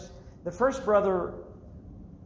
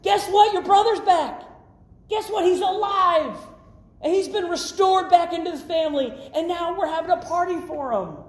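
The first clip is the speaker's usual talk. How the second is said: high-pitched, thrilled announcement; breaking happy news in an elevated voice; excited servant; 10 semitones higher, 4 dB louder than usual. Acted. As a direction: burst out with the good news. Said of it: "The servant's excited".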